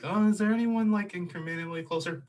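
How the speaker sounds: very high, loud put-on questioning voice; high mock searching. Host acting out his hunt for a closer pair, high mock searching. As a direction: squeak it out like a hammed-up search.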